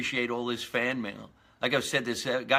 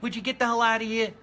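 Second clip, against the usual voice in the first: higher, fussy squirrel shooing off the lawn guy; hammed-up cranky squirrel, clearly higher; annoyed squirrel; 10 semitones higher, faster than usual. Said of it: "he's looking at him like".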